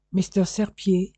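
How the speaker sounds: put-on a caller on the phone in a heavy English accent; strong English accent. Stranger on the phone greeting the police chief, strong English accent. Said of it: "une voix avec un fort accent anglais".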